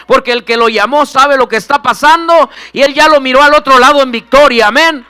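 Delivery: preaching at full force. Acted. Pastor urging the congregation to seek God on their knees, loud preaching climax. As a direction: build and build until you're nearly shouting the victory.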